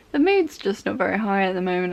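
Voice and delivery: ordinary delivery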